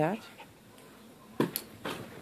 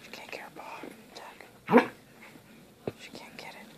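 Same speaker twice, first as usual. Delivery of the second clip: hushed narration so the dog won't hear; breathy whisper, barely audible; 3 semitones higher, 17 dB quieter than usual. Real, not acted. Whispering about the dog nearby.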